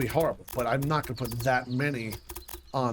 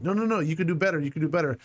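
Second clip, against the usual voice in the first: mildly raised, insistent nagging brain; angry inner voice; 3 semitones higher, faster than usual. Acted. Gamer's brain scolding him to do better, mock-angry nagging. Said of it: "It gets all angry and says".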